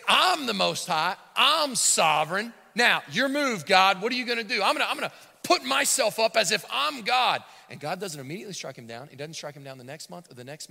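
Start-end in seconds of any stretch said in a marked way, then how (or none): 0.1-7.4 boastful king. Retold or imitated. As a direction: throw the challenge at God. Voicing proud Nebuchadnezzar.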